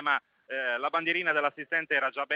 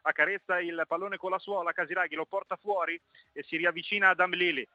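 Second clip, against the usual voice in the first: usual speaking voice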